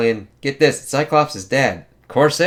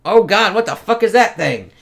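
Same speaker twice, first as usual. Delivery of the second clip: higher and louder mock alarm; mock panic; 9 semitones higher, 7 dB louder than usual. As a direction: gasp it out like you've just seen a monster. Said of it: "It's like".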